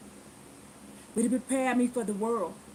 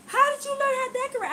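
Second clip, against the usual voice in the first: high, fast, eager question; gushing lady asking; 11 semitones higher, faster than usual. Retold or imitated. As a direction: gush the question, pitched way up. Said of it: "She said".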